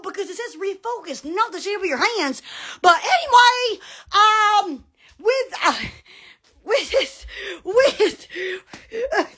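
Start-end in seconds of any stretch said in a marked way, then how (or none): none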